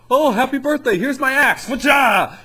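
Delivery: very high, loud manic shout; murderous Frosty. Pretend. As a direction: scream it like a cheerful killer snowman swinging an axe. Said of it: "what if Frosty was, like, a murderer".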